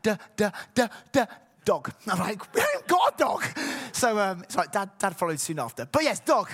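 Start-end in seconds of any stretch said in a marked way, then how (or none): 0.0-1.9 toddler imitation. Imitating baby son's first word. 2.5-3.4 high, loud exclaimed protest; mock shout. Dad protesting to his toddler, mock shout. Retold reply to son.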